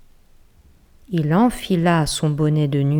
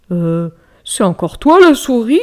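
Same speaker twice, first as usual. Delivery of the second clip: a touch of nervous bear; 4 semitones higher, 7 dB louder than usual. Acted. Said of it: "fit-il".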